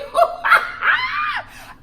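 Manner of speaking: very high shrieking laugh. Real, not acted. Vlogger laughs at the dad's blunt reply, shrieking laugh. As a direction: crack up high and loud.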